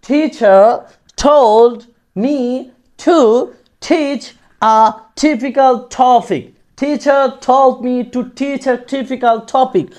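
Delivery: very slow, word by word, with hard aspirated t; careful slow teacher pronunciation demo; slow model reading. Shown as a demonstration. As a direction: model the twister slowly for students to copy. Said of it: "এরকমভাবে উচ্চারণ করার চেষ্টা করব".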